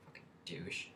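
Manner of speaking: a hissed aside after walking off; whispered insult. Retold or imitated. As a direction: mutter the insult so he can't hear. Muttered insult after leaving.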